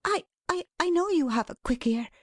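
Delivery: high, stammered start then a quick rush; flustered Dot. Acted. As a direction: cover your nerves by hurrying on. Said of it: "talking on as fast as she could".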